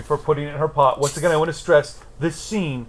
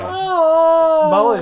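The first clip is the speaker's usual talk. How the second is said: loud slow reread; 12 semitones higher, 7 dB louder than usual. Real, not acted. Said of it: "Fuck, okay".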